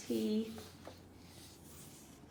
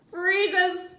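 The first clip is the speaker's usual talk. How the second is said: usual speaking voice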